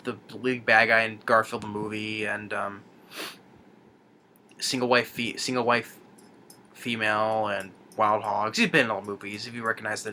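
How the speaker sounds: ordinary delivery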